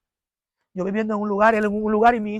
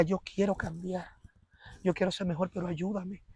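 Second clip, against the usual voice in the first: soft plea; 11 dB quieter than usual. Retold or imitated. Husband begging his wife to help him change, soft. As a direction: beg for help quietly. Husband's pleading voiced in retelling.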